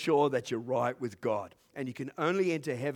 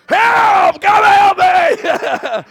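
much higher, much louder, slow panicked yell; desperate shout for help; 23 dB louder, much slower than usual. Retold or imitated. Man pinned by dogs yelling for help. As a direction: scream it out like you're under attack. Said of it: "I didn't shout it like that".

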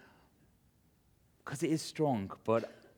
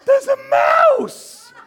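very high, drawn-out mock scream; blood-curdling shriek; 13 dB louder, much slower than usual. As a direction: imitate a panicked wife's loud stage-whisper shriek. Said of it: "there was a blood-curdling voice".

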